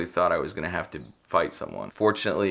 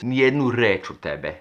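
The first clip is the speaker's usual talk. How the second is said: imitating the Serbian guy; 4 semitones higher than usual. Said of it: "which basically means".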